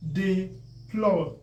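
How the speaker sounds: "Deploy" as dee, pause, ploy; split by syllable. Shown as a demonstration. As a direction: break "Deploy" into two separate beats. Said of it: "I'm breaking it according to the syllable".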